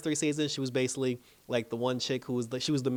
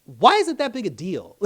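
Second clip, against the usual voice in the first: exasperated; 8 semitones higher, 9 dB louder than usual. Retold or imitated. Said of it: "And I'm just like".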